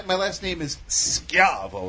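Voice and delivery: Schiavo said slowly as SKYAH-voh, proper Italian; Schiavo, hard sk and open ah, slowed. Shown as a demonstration. Telling friends the proper sound of his surname, slowly. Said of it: "if you pronounce it the proper way".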